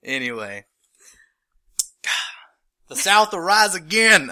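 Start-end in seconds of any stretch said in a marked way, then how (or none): 2.9-4.3 put-on loud, high Southern-accent holler; mock Southern rallying cry. North Carolinian joking about South versus North, hollered Southern accent. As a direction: shout it like a joking Southern rebel. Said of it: "comes out every now and then".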